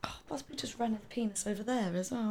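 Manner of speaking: unvoiced whispered aside; whispering. Real, not acted. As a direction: whisper it off mic. Aside about the dog.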